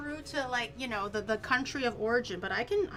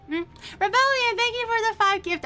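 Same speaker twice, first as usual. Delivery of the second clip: high, loud burst of thanks to a gifter; excited thanks; 10 semitones higher, 9 dB louder than usual. Real, not acted. Streamer thanking Rebellion for gifts, excited and loud. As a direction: shout out the gift with real excitement.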